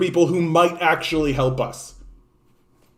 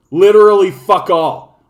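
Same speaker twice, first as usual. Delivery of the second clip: loud; 3 semitones higher, 8 dB louder than usual. Real, not acted. Angry rant climax.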